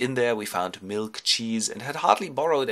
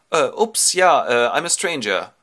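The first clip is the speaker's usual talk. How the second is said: loud, halting mock-awkward answer; flustered stranger; 5 semitones higher, 8 dB louder than usual. Ulysses introduces himself to the princess, lightly hammed-up fluster. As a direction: stumble through it loudly, with uhs. Shipwrecked man answers, acted.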